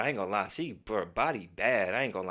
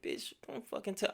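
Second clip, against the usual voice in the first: much higher, softer disbelieving retort; incredulous; 10 semitones higher, 10 dB quieter than usual. Retold or imitated. Driver shooting back at pushy friend, high disbelief. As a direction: squeak it out in disbelief.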